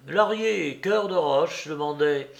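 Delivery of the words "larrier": usual speaking voice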